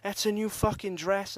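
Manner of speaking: high, loud furious yell that fades toward the end; mock the angry boyfriend yelling about the dress; retold angry shout. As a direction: throw the raging boyfriend's rant back at him. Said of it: "shouting in my face".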